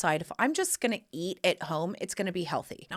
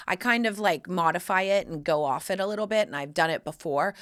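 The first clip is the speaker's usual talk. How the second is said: usual speaking voice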